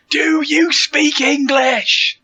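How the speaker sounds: slow, high-pitched tourist shout; man shouting. Acted. Player mocks a man shouting at foreigners, slow shouting. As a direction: shout it slowly, pitched well up. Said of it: "a man shouting and going".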